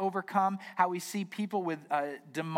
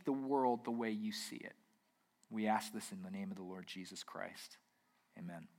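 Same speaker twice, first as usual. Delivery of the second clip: hushed, lower prayer voice with long pauses; earnest prayer; 6 semitones lower than usual. Real, not acted. Leads the congregation in prayer.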